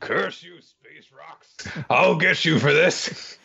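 high, slow, hammed-up villain curse; sputtering foiled villain. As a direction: vow revenge like a cartoon baddie.

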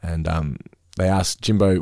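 normal talking voice

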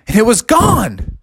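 plain reading voice

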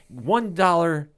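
amped-up host selling the tiers. Real, not acted. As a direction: get carried away pitching the club. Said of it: "this is when I get so amped up".

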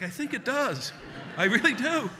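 put-on high, loud child's protest; hurt kid protesting. Injured boy answers his yelling mom, high protest. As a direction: plead back in a pained kid voice.

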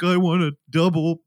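lightly imitated tearful order; mock sobbing. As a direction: order food as if tearful, understated. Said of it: "he's, like, sobbing".

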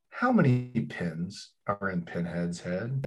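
hushed quiz-host whisper. Acted. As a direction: breathe the question softly. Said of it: "quiz question whispered".

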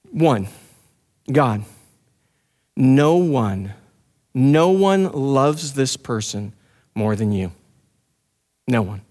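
hushed, intimate petition to God; demonstrated prayer. Shown as a demonstration. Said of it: "the kind of prayer I'm talking about goes more like this".

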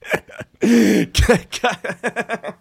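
high cackle. Real, not acted. Big laugh after nose burgers.

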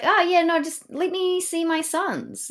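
mock pleading father. Co-host voicing Thyestes asking for his sons, mock pleading. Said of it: "Thyestes is like".

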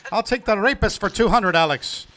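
imitating Sean Connery. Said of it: "Daryl Hammond on Celebrity Jeopardy doing".

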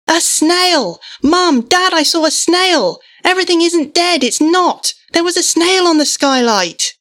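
put-on high, loud, thrilled child shout; excited yelling. A narrator's reading. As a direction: yell out the big discovery, breathless with joy. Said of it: "yelled his newfound truth".

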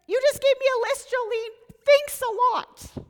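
high, fast, sarcastic retort; mock sarcastic thanks. Mock reply to being given list.

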